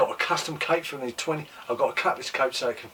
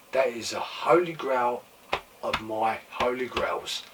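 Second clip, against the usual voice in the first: usual speaking voice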